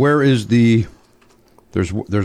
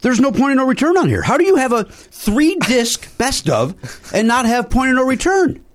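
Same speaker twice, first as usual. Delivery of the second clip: exasperated; 10 semitones higher than usual. Real, not acted. Outraged over missing song.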